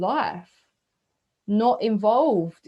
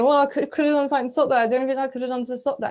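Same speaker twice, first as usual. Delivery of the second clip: rushed regret; 5 semitones higher, 6 dB louder, much faster than usual. Retold or imitated. Voicing regretful thoughts.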